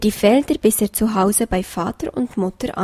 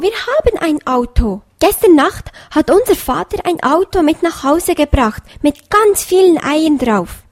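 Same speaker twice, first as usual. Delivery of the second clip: eager, higher chatter calming a little; excited fox children; 6 semitones higher, 6 dB louder than usual. A narrator's reading. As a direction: still keen, telling the news. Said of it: "riefen die Füchslein durcheinander".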